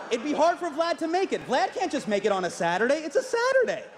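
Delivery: comic protest rising to a squeal; mock protest. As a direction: plead his case in mock indignation, ending way up high. Mock excuse for absent leader.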